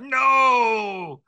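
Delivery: mock indignant actor voice; a hint of an offended actor; Stephen Fry. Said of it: "Stephen Fry or someone is like".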